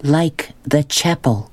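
each word set apart, distinct and firm; slow and clear. Acted. Girl explains her name to the boys, slow deliberate clarity. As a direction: spell it out slowly, word by word. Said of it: "she said slowly, making each word clear and strong".

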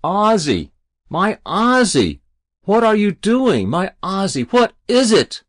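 high, wailing, alarmed questions; distressed mother. A narrator's reading. Mother to her son on the roof, bewildered distress. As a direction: call up to your child in alarm.

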